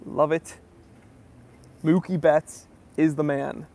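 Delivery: normal talking voice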